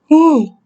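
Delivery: short high "woo" sliding down; high yawning woo. Real, not acted. Reader yawning mid-recording, high falling woo.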